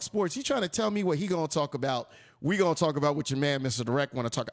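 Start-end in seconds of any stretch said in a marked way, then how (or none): none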